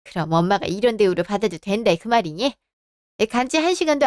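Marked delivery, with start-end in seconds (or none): none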